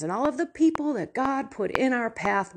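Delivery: usual speaking voice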